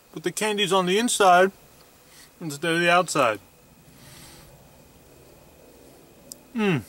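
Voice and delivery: talking between bites; muffled chewing speech; mouth full chewing. Real, not acted. Seen 2: Chews with closed, puffed lips while holding a bacon-wrapped snack.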